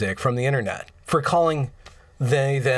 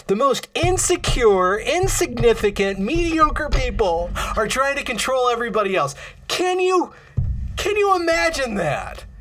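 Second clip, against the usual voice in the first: indignant rant; 7 semitones higher than usual. Real, not acted. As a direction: spit the words out in outrage. Ranting against online critics.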